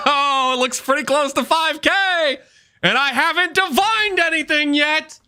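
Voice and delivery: high, laughing brag; laughing gloat. Real, not acted.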